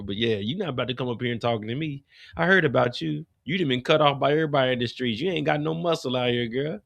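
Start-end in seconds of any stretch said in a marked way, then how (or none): none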